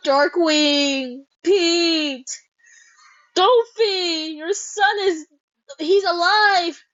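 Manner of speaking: high, exclaimed names; excited outburst. Real, not acted. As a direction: call out each name with delight. Cries out at character reveals.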